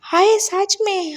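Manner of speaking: drawn out and squealed with joy; overjoyed disbelief. A narrator's reading. Wing, thrilled his wife agreed, asks in slow high delight. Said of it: "wing तो जैसे अब खुशी से पागल ही होने वाला था".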